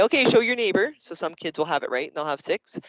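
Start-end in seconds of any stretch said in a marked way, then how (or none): 0.0-0.9 louder, higher teacher call; calling to class. Acted. Teacher telling kids to show their neighbor, raised classroom voice. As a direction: call the instruction brightly to the kids.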